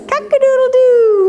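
high, long rooster call; rooster crow. Pretend. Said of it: "Who says".

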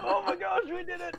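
high, thrilled cheer; excited squeal. Real, not acted. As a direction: squeal with real joy at finishing. Celebrating the finished game art.

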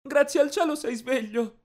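high-pitched, emotional mother; tearful relief. A narrator's reading. Mother seeing her son awake from coma, tearful relief. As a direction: cry out thankfully in a high voice. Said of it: "disse mentre le lacrime scorrevano".

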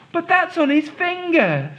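put-on high surprised little girl. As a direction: object with wide eyes. Molly objects.

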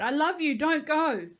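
high, loud desperate plea; crying out. A narrator's reading. Nico begs Josie not to leave, crying out. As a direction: beg at the top of the voice. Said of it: "Nico cried".

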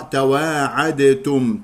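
"تواعدتم" said tawaa'adetum, slow, with a released d before t; dal pronounced separately. Shown as a demonstration. Teacher warning of a mistake, "تواعدتم" slowly with audible d. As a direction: sound out the d in "تواعدتم", then t. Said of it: "لا تقرأ".

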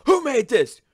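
loud, high hammed-up demand; mock kitchen crasher. Friend mimics trend guys yelling into a fast food kitchen, loud and high. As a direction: bellow it at fast food staff. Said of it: "Dudes would go into kitchens ... and be like".

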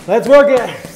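loud, sharp coach call over music; shouted command. Real, not acted. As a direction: call it out to the class. Coach calling out boxing commands.

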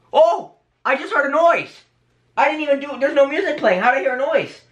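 high, puzzled astonishment; startled surprise. Real, not acted. Vlogger hearing a sudden noise, high and startled. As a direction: react high-pitched to something unexplained.

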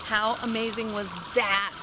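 ordinary talk

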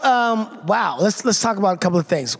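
ordinary delivery

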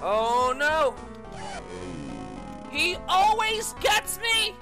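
normal talking voice

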